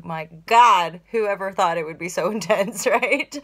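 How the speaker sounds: loud outburst breaking into laughter; laughing exclamation. Real, not acted. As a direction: start loud, let laughter take over, rising at the end.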